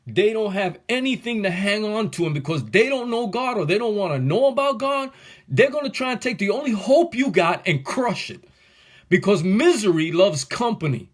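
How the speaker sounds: real fire in the sermon; riled up. Real, not acted. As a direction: build into a passionate rant. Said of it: "I'm getting riled up".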